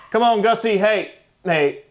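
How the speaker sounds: high, loud call to a dog. Real, not acted. Vlogger breaks off to call his dog over, high and loud.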